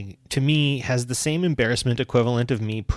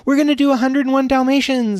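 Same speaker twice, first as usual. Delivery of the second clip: excited staff; 14 semitones higher, 6 dB louder than usual. Retold or imitated. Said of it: "they're like".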